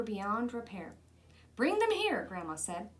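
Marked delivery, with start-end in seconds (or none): 1.6-2.2 high, loud call across the room. Acted. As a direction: call the boy to bring them. Grandma calling for the pants.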